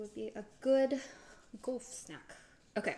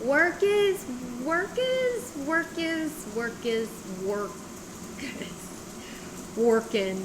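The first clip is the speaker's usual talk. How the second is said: stalling; 8 semitones higher, 7 dB louder, much slower than usual. Real, not acted. Hesitant comic stalling.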